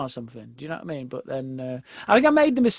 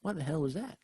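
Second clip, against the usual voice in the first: muttered under the breath, far softer; a stunned star mumbling to himself; hushed mock disbelief; 9 dB quieter than usual. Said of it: "he sort of went".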